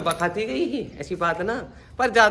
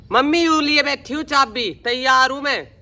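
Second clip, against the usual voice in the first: calling out; 6 semitones higher, 6 dB louder than usual. Real, not acted. Calling out to mother.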